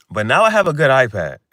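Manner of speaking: loud, proud brag; boasting loudly about the new iPad. Real, not acted.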